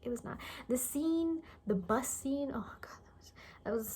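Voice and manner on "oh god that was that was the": disbelieving whispered aside. Real, not acted. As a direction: breathe it out as a whisper, appalled. Aside about the stupid bus scene.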